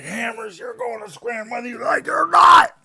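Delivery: angry boss yelling at the worker; livid boss. Retold or imitated. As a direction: bellow it like an enraged boss. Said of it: "my boss came out and fucking got livid".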